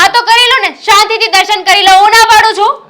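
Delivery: loud, high sarcastic snap; wife angrily yelling at her husband at prayer; angry. Acted. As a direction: shout back at him in anger. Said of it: "ધીમે ધીમે બોલ, આટલો બધો ગુસ્સો".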